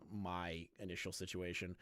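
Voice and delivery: usual speaking voice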